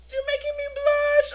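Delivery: very high, loud squeal; mock bashful. Star answers a crying fan, high mock bashfulness. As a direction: imitate him in a silly coy squeal.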